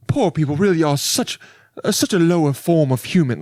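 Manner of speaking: posh British lady. Acted. Rich lady looking down on the poor, posh British disdain. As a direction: play a disdainful British snob. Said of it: "like, posh British person".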